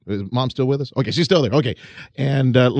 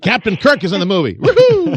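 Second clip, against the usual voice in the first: excited; 8 semitones higher, 5 dB louder than usual. Real, not acted. Excited about Captain Kirk.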